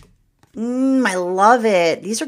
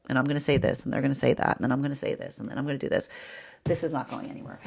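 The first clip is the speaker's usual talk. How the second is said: quiet scheming voice; 3 semitones lower, 7 dB quieter, faster than usual. Retold or imitated. Scorpio inner voice, plotting the talk.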